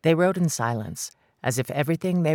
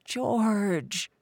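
pleading; 7 semitones higher than usual. Acted. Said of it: "she pleaded".

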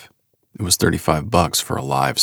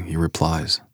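ordinary talk, nothing marked

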